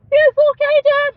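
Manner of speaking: put-on high, loud toddler voice; little kid voice. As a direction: squeak it out like a three-year-old reassuring his dad. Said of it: "this little three-year-old ... goes".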